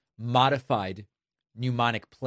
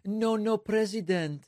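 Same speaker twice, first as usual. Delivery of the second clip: higher-pitched imitation Mexican accent; mock Mexican accent; 9 semitones higher than usual. Host mocking Trump's impression of Mexico's president, mock Mexican accent. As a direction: mimic an exaggerated Mexican-accented English. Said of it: "doing his best impression of a Mexican".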